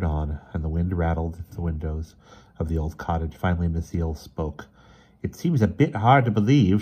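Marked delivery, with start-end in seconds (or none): 5.2-6.8 raised, doubtful character voice; weak, throaty. A narrator's reading. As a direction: voice her doubt in a lightly acted throaty tone. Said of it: "she said in a weak, throaty voice".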